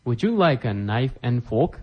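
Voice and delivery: slow, careful model reading; slow repetition. Shown as a demonstration. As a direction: spell it out slowly for learners. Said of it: "teacher repeats phrase for learners".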